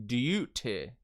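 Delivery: DUTY slowly as 'dyoo-teh'; fluid J. Shown as a demonstration. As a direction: show the Y glide in DUTY. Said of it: "use the fluid J".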